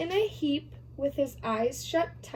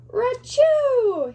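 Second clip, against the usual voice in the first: loud high sneeze; big sneeze; 11 semitones higher, 8 dB louder than usual. Acted. The bear is woken by a pepper sneeze, loud. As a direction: burst out a huge sneeze.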